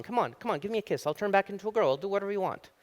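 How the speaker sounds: high, coaxing frog voice; insistent frog princess. Acted. Frog keeps begging the silent guy for a kiss, high insistent voice. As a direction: keep nagging in a high little voice. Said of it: "frog keeps talking".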